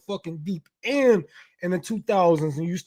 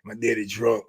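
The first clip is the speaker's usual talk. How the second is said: much lower, heavy mopey fan voice; mock sulking fan; 11 semitones lower than usual. Commentator mocks a betrayed fan in a much lower, mopey voice. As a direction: drag it low like a whiny, beaten-down fan.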